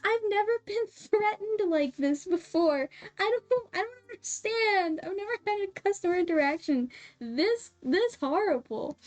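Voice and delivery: high, hammed-up panicky stammer; mock terrified. Clerk retells reacting to a threat from a customer, mock terrified. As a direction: squeak it out as if overwhelmed by fear.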